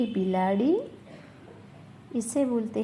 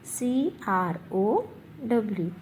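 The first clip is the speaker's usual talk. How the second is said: letters spaced out slowly; slow spelling dictation. Shown as a demonstration. Teacher spelling crow for pupils, slowly. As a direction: spell each letter for the children to copy. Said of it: "जिसकी spelling है".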